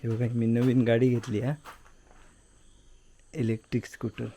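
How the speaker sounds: hushed, low voice so nobody wakes; quiet at night. Real, not acted. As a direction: keep it soft, family's asleep. Home late at night, everyone asleep.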